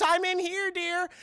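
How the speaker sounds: high, sing-out mom answering. Retold or imitated. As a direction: imitate a mother calling back sweetly from another room. Mom answers back from kitchen.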